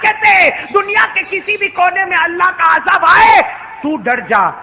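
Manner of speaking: shouted, high sermon delivery; preaching at full force. Acted. Preacher roaring at the Eid congregation, full force preaching. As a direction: thunder it out to the crowd like a fiery preacher.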